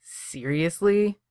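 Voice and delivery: slow, drawn-out disbelief; incredulous. A narrator's reading. Kaner to Johnny, incredulous. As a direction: stretch it out in disbelief. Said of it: "looks at him incredulously".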